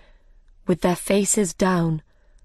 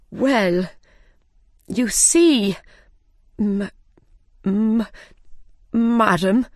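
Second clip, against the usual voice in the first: nervous stammer; 3 semitones higher, slower than usual. Acted. Frightened gardener stammers.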